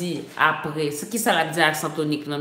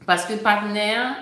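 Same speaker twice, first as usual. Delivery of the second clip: normal talking voice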